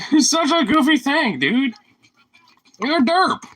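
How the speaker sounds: high giggly doggy voice; laughing pet talk. Real, not acted. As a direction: giggle it at the goofy dog. Laughing at the dog.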